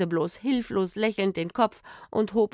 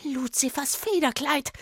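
high, hissed exclamation of dread; superstitious hiss, pitched up; 6 semitones higher than usual. A narrator's reading. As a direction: hiss it like a spooked old woman. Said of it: "zischte die Frau".

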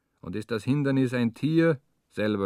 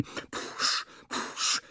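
panting breaths; 7 dB quieter than usual. Acted.

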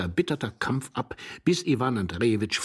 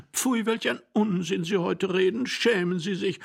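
higher, indignant woman's voice; scolding young wife; 10 semitones higher than usual. A narrator's reading. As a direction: scold the husband, pitched well up.

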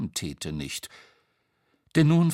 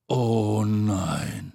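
drawn-out, sinking moan; dismayed lament; 4 dB louder than usual. Acted. Tete seeing the broken hat, dismayed. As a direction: let it fall slowly in dismay.

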